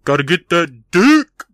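loud, drawn-out comic shout; crude mock exclamation. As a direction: belt out the dirty punchline. Crude joke, called out.

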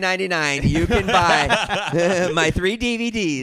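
loud, high, slow salesman hype; hammed-up TV pitch man; mock infomercial pitch. Friend mocking him as a TV pitch salesman.

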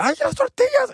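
very high-pitched mock cry; mock alarm. Grandson seeing grandma burn tortillas, mock alarm. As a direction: exclaim it way up high, in imitation.

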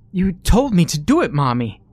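put-on a boy repeating himself in desperation; crying little boy. A narrator's reading. Johnny begs Mommy, high desperate child. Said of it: "Johnny cries".

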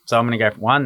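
ordinary talk, nothing marked

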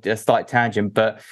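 ordinary talk

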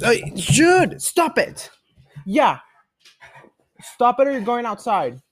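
high, loud stop it; scolding the dog. Real, not acted. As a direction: threaten the dog firmly.

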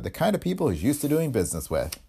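usual speaking voice

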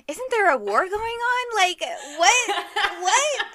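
high, drawn-out mock disbelief; incredulous outburst. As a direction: pitch it way up, utterly baffled. Acted disbelief at the plot.

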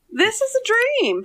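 high, slow dreamy gush; high mock gushing. Ironic gush about busy day.

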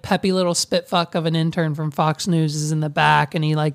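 usual speaking voice

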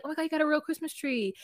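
high, fast, lightly gushing; mock excited. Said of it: "she's like".

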